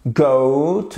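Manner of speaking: "goat" with a gliding oh-oo vowel; diphthong model. Shown as a demonstration. Teacher demonstrates the diphthong to students. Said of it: "notre diphtongue".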